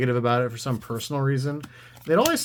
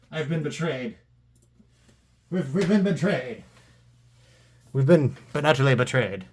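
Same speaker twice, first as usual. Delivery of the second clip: mock betrayal. Mock outrage at low card price.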